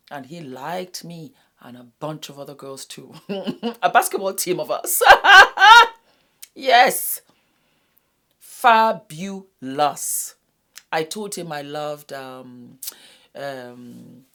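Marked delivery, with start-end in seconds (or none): 3.2-7.2 bursting into loud laughter; loud, giggly laugh over a joke. Real, not acted. Joking about many girls. 8.4-10.3 sarcastic. Real, not acted. Sarcastic comment on his girls.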